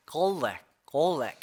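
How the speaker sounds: garlic said slowly, L and R both sounded; demonstrated pronunciation. Shown as a demonstration. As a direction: say garlic drawn out with a full L and R. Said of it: "이렇게 발음이 납니다". (garlic을 L과 R 소리를 살려 천천히 발음)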